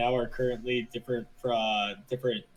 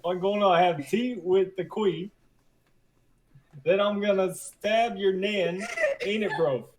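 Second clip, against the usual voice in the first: higher, slower, hammed-up English accent; a lightly done, bad mock English accent; 6 semitones higher, 4 dB louder than usual. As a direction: butcher a cockney English accent on purpose. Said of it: "I don't got a good English accent".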